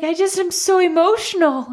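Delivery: high, thin imitation of crying; mock tearful. Crying wife answers her husband, retold tearful voice. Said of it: "I just started crying".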